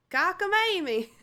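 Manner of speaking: high, loud "Cockamamie"; playful exclamation. Pretend. Streamer playing with an odd word, silly high exclamation.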